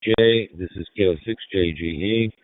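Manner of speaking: ordinary talk, nothing marked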